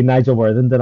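usual speaking voice

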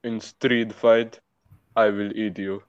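deliberate, measured Arabic-accent delivery; careful Arabic accent. Shown as a demonstration. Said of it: "I'll do it once more here carefully".

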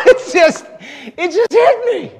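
high, loud, bursting with amazement; overwhelmed excitement. Acted. Preacher relives the verse hitting him, overwhelmed. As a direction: blurt it out, still reeling.

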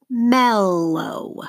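"Mellow" with MEL drawn out; stress on first syllable. Shown as a demonstration. Teacher models for seventh graders to mark, stretched stressed MEL in "Mellow". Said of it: "circle the stress syllable".

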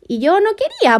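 "quería" pitched high and stretched; whiny retold protest, leaning hard on "quería"; emphatic protest. Telling friend she didn't want to go, protesting. Insisting on her reluctance in retelling.

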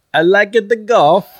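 put-on high, loud botched Scottish accent that lands on Borat; Scottish gone wrong, loud and high; terrible Scottish accent. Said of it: "The worst Scottish accent ever".

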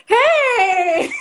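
laughing high shout of welcome; excited high hey. Real, not acted. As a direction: burst out in delight as she joins. Guest finally joins the live.

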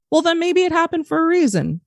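high, conciliatory retold dad; lifted pitch, softening the blow; reassuring dad. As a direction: imitate a parent gently offering a silver lining. Voicing dad's unexpected reassurance.

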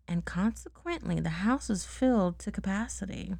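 ordinary delivery

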